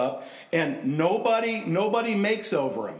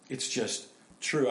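ordinary talk, nothing marked

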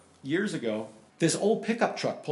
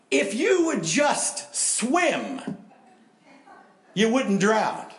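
mocking preachy advice; 7 semitones higher, 4 dB louder, much slower than usual. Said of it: "what are you gonna say".

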